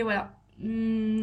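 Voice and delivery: normal talking voice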